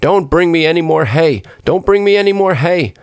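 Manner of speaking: high-pitched storybook holler; crying out loud. A narrator's reading. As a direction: call out high, insistently repeating the plea. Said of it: "cried out as loud as he could".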